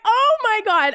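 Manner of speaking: high, stretched mock gasp of disbelief; hammed-up dismay; retold shock. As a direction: draw it out, scandalized.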